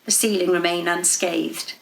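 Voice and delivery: usual speaking voice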